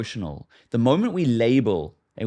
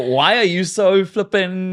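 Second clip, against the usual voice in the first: louder, higher, drawn-out mock challenge; hammed-up combative dig; cocky, confrontational; 7 semitones higher, 6 dB louder, slower than usual. Shown as a demonstration. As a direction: demand it as if starting a fight. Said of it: "don't say it in a cocky way".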